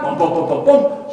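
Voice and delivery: quick, high-pitched booms; imitated running footsteps. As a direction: pound out hurried footsteps by voice. Replays the footsteps.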